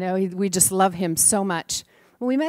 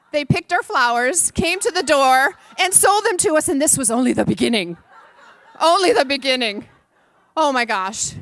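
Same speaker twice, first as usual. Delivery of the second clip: louder, higher laughing retelling; laughing disbelief; 6 semitones higher, 6 dB louder than usual. Real, not acted. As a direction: laugh as you tell it. Laughing retelling of kid scheme.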